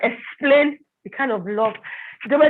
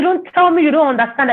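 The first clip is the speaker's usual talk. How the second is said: higher, louder accusing retort; retold scolding; 7 semitones higher, 6 dB louder than usual. Accuser confronting the baffled wife, scolding. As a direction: scold her, imitating the accuser.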